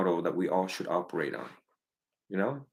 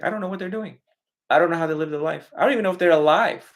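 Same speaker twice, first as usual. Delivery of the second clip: worried parent; 6 semitones higher, 4 dB louder, faster than usual. Retold or imitated. Parent voiced, worrying about kids.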